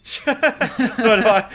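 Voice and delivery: laughing. Real, not acted. Laughing at pay cut joke.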